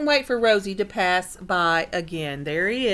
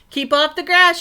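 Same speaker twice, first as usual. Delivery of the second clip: loud, higher shout; 7 semitones higher, 7 dB louder than usual. Acted. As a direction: yell it to warn him off. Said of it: "shouted Hank".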